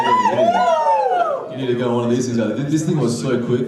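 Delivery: normal talking voice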